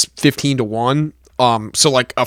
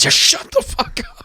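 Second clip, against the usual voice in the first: a higher, whispered snap; retold whispered brush-off, higher; mock hissed snap; 11 semitones higher than usual. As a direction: hiss it at the guy in your ear. Snapping at the golf-talk guy.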